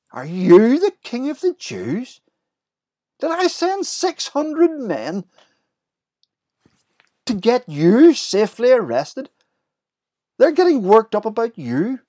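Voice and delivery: incredulous outburst rising high and loud; astonished. Retold or imitated. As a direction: Pilate staring amazed at the harmless Galilean. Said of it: "you can almost hear the astonishment".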